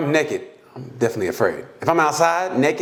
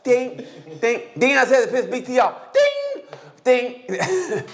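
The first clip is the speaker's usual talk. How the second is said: higher, sing-song ding dings; mock bell; 9 semitones higher than usual. Announcing he got pissed, sing-song dings. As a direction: ring it like a game show bell.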